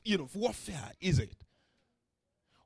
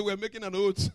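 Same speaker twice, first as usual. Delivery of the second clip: laughing; 3 semitones lower, 3 dB quieter than usual. Real, not acted. Laughing at the sons.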